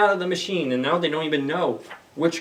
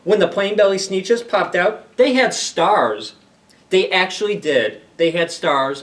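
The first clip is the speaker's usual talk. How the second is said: lively, louder storybook reading; reading to children; 6 semitones higher, 8 dB louder, slower than usual. Acted. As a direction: read it like story time at the library. Reads picture book aloud.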